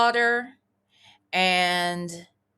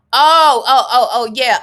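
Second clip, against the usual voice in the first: excited realization; 3 semitones higher, 12 dB louder than usual. Real, not acted. Suddenly remembering the show.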